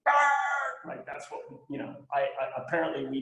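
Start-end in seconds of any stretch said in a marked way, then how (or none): 0.1-0.9 high, drawn-out yelled name; retold scream. Dead friend calling to him in meditation, mock scream. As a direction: imitate a friend screaming out, pitched way up. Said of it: "like, screaming".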